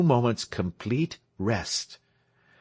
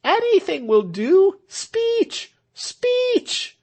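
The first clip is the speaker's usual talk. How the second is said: crowd demanding; 5 dB louder, much slower than usual. A narrator's reading.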